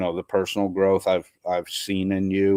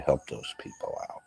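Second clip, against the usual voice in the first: whispered; 6 semitones lower, 11 dB quieter than usual. Real, not acted. Soft aside closing appeal.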